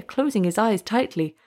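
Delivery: plain reading voice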